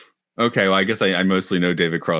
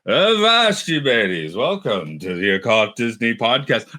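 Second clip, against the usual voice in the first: put-on pirate accent, second try; 2 semitones higher than usual. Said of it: "Actually, I'm doing that wrong for this movie".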